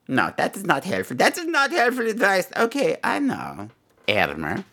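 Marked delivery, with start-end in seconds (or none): none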